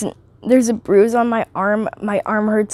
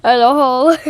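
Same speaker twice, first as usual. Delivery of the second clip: carefree defiance; 4 semitones higher, slower than usual. Retold or imitated. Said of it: "I'm like".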